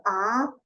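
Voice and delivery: A loud with the mouth open wide; open vowel A. Shown as a demonstration. Teacher showing the class an open A. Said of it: "establezco una vocal abierta".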